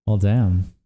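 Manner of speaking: ordinary talk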